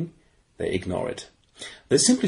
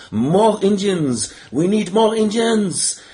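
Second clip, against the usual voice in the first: hammed-up plea, higher and slow; mock wail; 7 semitones higher, 4 dB louder, slower than usual. French player begging for more Indians, mock wailing. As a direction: plead for more like a mock lament. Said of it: "A big wail by the French ensues".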